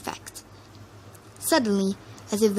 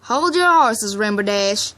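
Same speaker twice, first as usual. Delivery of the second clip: reining in an impatient friend; firm order; 6 dB louder than usual. A narrator's reading. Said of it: "Applejack ordered".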